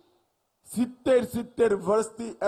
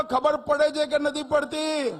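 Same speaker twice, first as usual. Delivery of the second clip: louder repeat; 5 semitones higher than usual. Shown as a demonstration. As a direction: shout it to the crowd, louder. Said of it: "અરે જોરથી બોલો".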